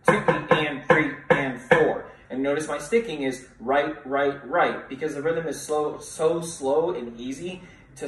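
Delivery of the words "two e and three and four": louder count with the class; louder rhythmic group count; counting along. Shown as a demonstration. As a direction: lead the class through the bar. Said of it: "read it with me".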